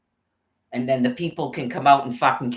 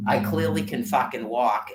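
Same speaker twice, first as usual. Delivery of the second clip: ordinary delivery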